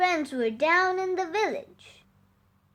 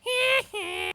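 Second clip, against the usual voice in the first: high, short gleeful laugh; cheeky laugh; 8 semitones higher, 4 dB quieter than usual. Acted. Sam mocking the villagers, high gleeful giggle. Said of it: "Sam just laughed".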